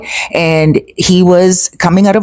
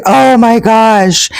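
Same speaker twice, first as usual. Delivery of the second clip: slow, higher-pitched recalled astonishment; retold amazement; 5 semitones higher, slower than usual. Hearing he paid five hundred bucks, retold drawn-out amazement. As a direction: draw it out, reliving the shock. Reacting to the bribe amount.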